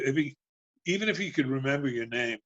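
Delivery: ordinary talk, nothing marked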